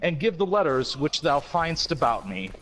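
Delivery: slow, solemn recital of the lyrics; dramatic lyric reading. Acted. Reading Walrus lyrics aloud to co-hosts, slow and mock-solemn. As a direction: read it like grave poetry, taking your time.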